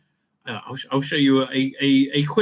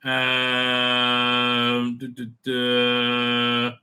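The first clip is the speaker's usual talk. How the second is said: usual speaking voice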